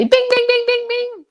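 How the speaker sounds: high, loud bing-bing game-show bell; bright game-show ding ding; correct-answer bell. Acted. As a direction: ring it out like a quiz buzzer.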